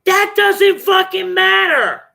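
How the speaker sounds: slow, high, loud furious shout; angry shout. Real, not acted. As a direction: yell it out in real anger. Angry outburst about ex hitting her.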